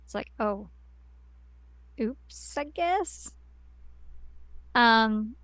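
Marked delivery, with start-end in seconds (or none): 0.4-3.3 small, soft voice rising at the end; mock-sheepish, halting half-apology; sheepish oops. Acting Mary's reply to bad news.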